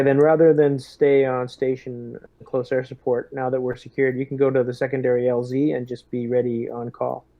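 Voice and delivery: ordinary delivery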